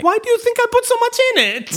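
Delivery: high comic put-on voice; character voice. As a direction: pipe it up like a sly host. Quoting a character line.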